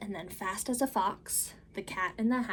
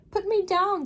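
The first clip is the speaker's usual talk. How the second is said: much higher, louder, anxious little fish; fretful fish; 10 semitones higher, 8 dB louder than usual. Acted. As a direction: squeal out the protest as a fish. Fish protests again.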